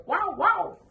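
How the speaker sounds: high loud playful woof; Spanish dog bark. Shown as a demonstration. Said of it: "in Spanish, dogs don't go".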